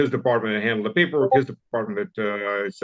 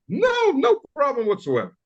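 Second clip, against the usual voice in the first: higher, offhand mock lieutenant; breezy lieutenant reply. Armenian lieutenant tells him there was no problem, breezy. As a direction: toss it off lightly as the lieutenant.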